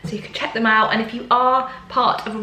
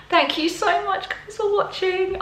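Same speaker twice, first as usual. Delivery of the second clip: cheerful sign-off; 11 semitones higher than usual. Real, not acted. Sing-song sign-off.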